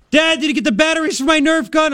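put-on high kid voice, eager and pleading; imitating his kid. Dad retells his son asking about batteries, high kid voice. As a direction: whine it up like a little boy wanting his toy.

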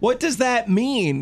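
imitating confusion. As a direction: ask it baffled and drawn out. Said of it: "you're like".

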